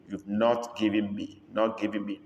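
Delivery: normal talking voice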